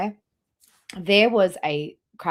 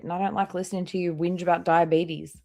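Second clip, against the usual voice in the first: ordinary talk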